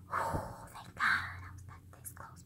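barely audible, breath-only whisper; relieved whisper. Retold or imitated. Relieved after he walks away, secret whisper.

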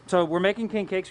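ordinary delivery

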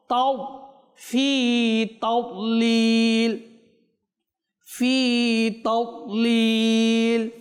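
slow, melodic tajweed chant; slow chanted Quranic recitation. Shown as a demonstration. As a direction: draw out each Arabic syllable in measured recitation. Teacher recites verses slowly.

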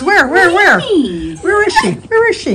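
high, slow searching puppy voice with a laugh; be the puppy looking for her owner; voicing the dog. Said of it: "She says".

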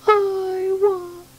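high, very slow and plaintive; a little one on the edge of tears; sad baby bird. Acted. Homesick baby bird longs for its mother, high and slow.

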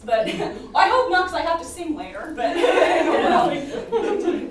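laughing through the words. Real, not acted. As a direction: giggle while talking. Laughs at own joke.

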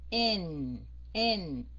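N held and sliding low into a hum; nasal falling N. Shown as a demonstration. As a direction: let the final N ring in the nose. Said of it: "xuống giọng mũi". (N ngân dài, xuống giọng mũi)

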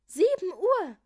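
high, loud shocked exclamation; startled cry. A narrator's reading. Julia seeing the late hour, high startled cry. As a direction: cry out in alarm at the time. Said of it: "rief Julia erschrocken".

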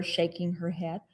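usual speaking voice

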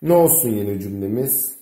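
ordinary talk, nothing marked

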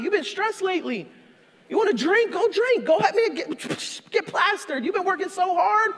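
high, coaxing devil egging you on; tempting Satan. Retold or imitated.

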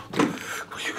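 ordinary talk

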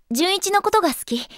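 restrained, tender declaration; earnest confession. Acted. Girl admitting she loves her friend, restrained and earnest. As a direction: confess it simply, without raising the volume.

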